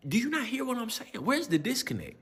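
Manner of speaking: high, frustrated questions; mock outrage at the kids; exasperated rant. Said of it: "I'm generally getting mad".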